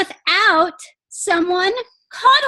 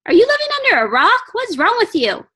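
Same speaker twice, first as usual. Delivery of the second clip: fast, loud, higher teasing outrage; playful incredulous scolding; mock disbelief; 5 semitones higher, 3 dB louder, faster than usual. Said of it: "And I'm like".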